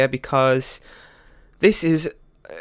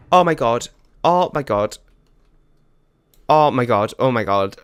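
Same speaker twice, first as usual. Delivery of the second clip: stunned, repeated gasping disbelief, louder; real shock; 3 semitones lower, 5 dB louder than usual. Real, not acted. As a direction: blurt it out again and again in real shock. Unplanned character suddenly appears.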